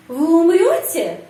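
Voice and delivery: умрёте punched high, falling at the end; question intonation. Shown as a demonstration. Teacher demonstrating a question sentence, strong stress on умрёте. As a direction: ask by hitting умрёте. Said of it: "Kalimat tanya".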